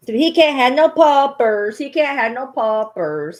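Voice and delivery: loud, high singsong start, easing down; playful pet talk. Acted. Owner teasing her freshly fixed dog, playful singsong.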